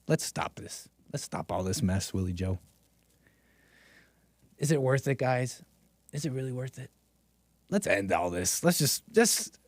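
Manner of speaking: soft, hushed mock-sympathy; sarcastic pleading. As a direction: pretend to beg him to give up, softly. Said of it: "I'm being ridiculously sarcastic".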